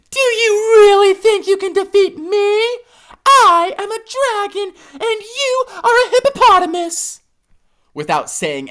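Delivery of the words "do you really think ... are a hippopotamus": high, louder mocking dragon voice; scoffing dragon. A narrator's reading. Dragon scoffing at hippo, high mocking voice. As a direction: laugh off the little threat. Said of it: "Laughing at the thought of dueling a hippopotamus".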